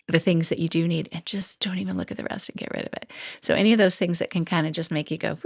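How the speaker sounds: usual speaking voice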